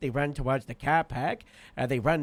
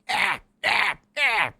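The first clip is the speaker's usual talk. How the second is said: rising, louder cries while running; higher and louder, imitating a charge; chasing yells; 5 semitones higher, 5 dB louder, slower than usual. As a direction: yell ah ah ah in pursuit. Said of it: "going like".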